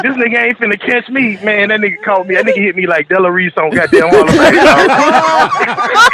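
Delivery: high, laughing retelling. Real, not acted. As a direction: tell it while cracking up.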